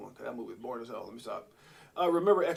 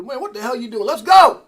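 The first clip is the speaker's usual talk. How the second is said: high, loud burst building into a yell; hyped-up mock shout; 10 semitones higher, 18 dB louder than usual. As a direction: act out wild impatience, exploding on the last words. Acts out excited streaming scene.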